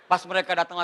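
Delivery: ordinary talk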